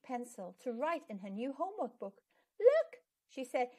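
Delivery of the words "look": high, loud delighted "Look"; proudly showing her work; excited call. Acted. Pip shows off her writing.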